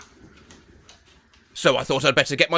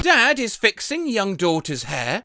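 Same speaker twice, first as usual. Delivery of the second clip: ordinary talk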